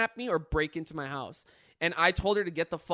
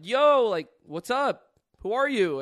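higher, louder call to a stranger; retold calling out; 6 semitones higher, 10 dB louder, slower than usual. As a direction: call it out from a window.

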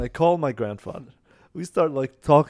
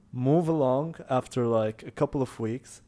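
usual speaking voice

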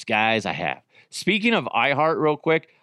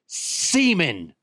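higher, swooping reveal; drawn-out punchline; 5 semitones higher than usual. Pretend. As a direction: draw the word out as a mock-grand suggestion. Mock pitch to heist crew.

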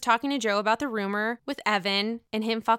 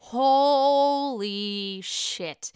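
drawn-out shock; much slower than usual. Real, not acted.

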